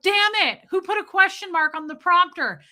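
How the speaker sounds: high, loud frantic yell; mock panic at the prompter slip; mock newsroom outburst. Mimicking the Anchorman boss yelling about the prompter. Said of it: "He was like".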